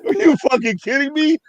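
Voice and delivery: high, loud, laughing disbelief; half-laughing "are you kidding me" at the cops; retold shocked outburst. Retelling his shocked reaction to the police lineup, high and loud.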